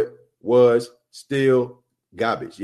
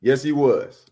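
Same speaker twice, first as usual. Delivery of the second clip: normal talking voice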